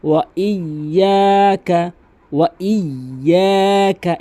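chanted ওয়া ইয়াকা with y held and long "য়া"; doubled y. Shown as a demonstration. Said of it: "এভাবে". (ওয়া ইয়াকা, তাসদিদে জোর দিয়ে)

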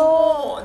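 "Cuma." high and loud; punchy Malaysian "Cuma."; clipped Malaysian slang "Cuma."; Malaysian short form. Shown as a demonstration. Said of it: "Dia lain sikit".

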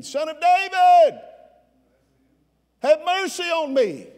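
loud, high-pitched shout for mercy; desperate cry. Acted. Blind man calling to Jesus over the crowd, desperate cry. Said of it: "he got louder".

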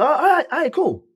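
high, bright-eyed yes; excited agreement. Retold or imitated. Agreeing to Teddy's records, excited and high. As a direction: jump at the offer with a high lift.

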